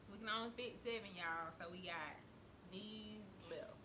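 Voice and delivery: ordinary talk, nothing marked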